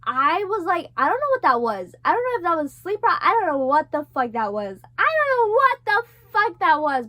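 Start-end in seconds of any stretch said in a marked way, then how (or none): 5.0-7.0 higher, louder, exclaiming in shock; loud disbelief. Real, not acted. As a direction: blurt it out, still rattled. Stunned after the nightmare.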